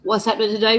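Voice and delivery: normal talking voice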